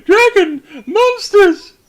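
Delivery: high, loud cries of terror; hammed-up villagers shrieking in panic; panicked screaming. Game master voicing terrified civilians, panicked high screams. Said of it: "They're panicking".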